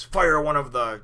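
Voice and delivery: high, loud command to fire; pirate captain yelling an order; barked order. Retold or imitated. Buggy orders cannon fire.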